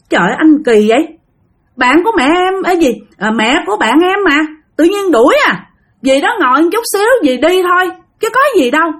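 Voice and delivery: high-pitched pleading objection, acted out; flustered protest. Wife protests against husband's rudeness. (giọng vợ phân bua, lên cao)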